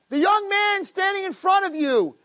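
very high, ringing declaration; dramatic reveal. Retold or imitated. Old man reveals the son to the king, high dramatic cry. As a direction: proclaim it at the top of your range.

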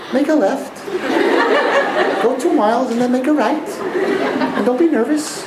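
a sweetly reassuring navigation voice, put on for laughs; nice GPS voice. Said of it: "That nice voice".

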